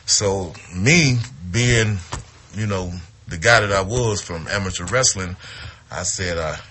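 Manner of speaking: usual speaking voice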